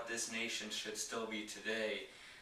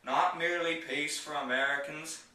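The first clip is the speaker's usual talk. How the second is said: raised, ringing presidential oratory in measured phrases; higher, louder, declamatory podium delivery; JFK impersonation; 3 semitones higher, 5 dB louder than usual. Acted. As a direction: give a famous speech in an imitated statesman's voice, loud and emphatic, pausing between phrases. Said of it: "going to do my J F K impersonation".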